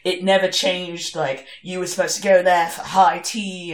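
usual speaking voice

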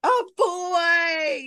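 high, loud excited cry. Real, not acted. Sister cheering the baby boy news, high excited cry. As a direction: squeal it out with joy.

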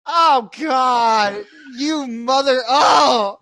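loud re-enacted cry of pain; mock agonized outburst. Son re-enacts his sore night, loud mock agony. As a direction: cry out and nearly curse, hammed up.

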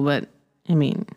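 ordinary delivery

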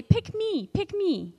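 high, eager classroom plea; hand-up pupil begging to be picked. Shown as a demonstration. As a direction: beg to be chosen like a keen pupil. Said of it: "like, you wanna say".

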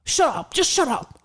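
imitating angry man. Imitating the man yelling at her, loud mock anger. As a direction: bark it like a rude stranger. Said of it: "He's like".

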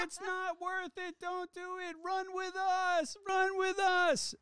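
high, slow, drawn-out mock plea; playful stretched-out heckle; retold yell. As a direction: holler it high as if calling to a groom while running past. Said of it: "and I yell out".

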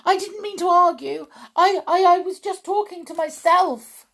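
very high, rapid, stammering excuse; panicked cry. A narrator's reading. Witch excuses herself to her leader, panicked cry. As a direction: gabble it out in fright. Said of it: "the witch cried out".

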